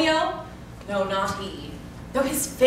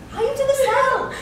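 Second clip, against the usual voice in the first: high excited call; 13 semitones higher, 3 dB louder than usual. Acted. Nurse shoos her off.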